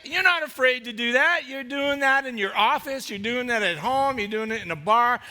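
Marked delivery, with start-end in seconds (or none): none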